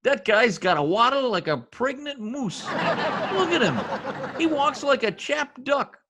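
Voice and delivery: high, laughing ridicule of the doctor; laughing mockery. Husband mocks the doctor's waddle to his wife, laughing high. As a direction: giggle while mocking his walk.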